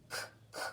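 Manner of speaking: "K, k" as two voiceless puffs of the k sound; isolated first sound. Shown as a demonstration. Teacher sounding out the start of cold, "K, k" as bare voiceless clicks. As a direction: sound "K, k" without voice, only the consonant. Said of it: "What do you hear at the beginning".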